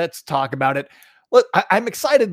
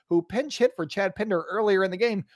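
usual speaking voice

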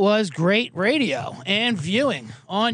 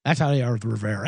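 ordinary talk, nothing marked

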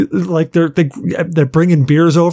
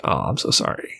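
retold sorry, whispered; whispering sorry to the bassist; whispered apology; 11 semitones lower, 9 dB quieter than usual. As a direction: breathe the apology out under the voice. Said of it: "I'm like".

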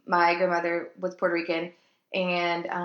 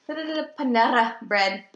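put-on valley girl; 4 semitones higher, 5 dB louder than usual. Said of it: "She's like".

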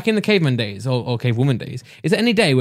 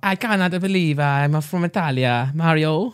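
slow, hammed-up Italian voice; mock Italian accent; slower than usual. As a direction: play a cartoon Italian plumber. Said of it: "can speak Italian".

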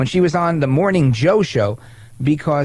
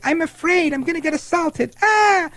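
much higher, whining mock fear ending in a shriek; mock whiny congresswoman; 13 semitones higher than usual. Host mocks AOC's complaints about Republicans, shrill mock whine. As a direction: ham up her panic, then squeal. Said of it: "hear her complain and whine".

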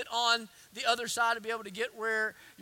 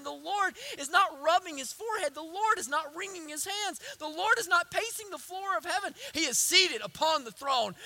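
higher, driving preacher's cadence; impassioned preaching; 6 semitones higher, 6 dB louder than usual. Real, not acted. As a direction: preach it with rising force.

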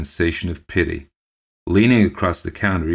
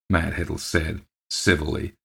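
ordinary delivery, nothing marked

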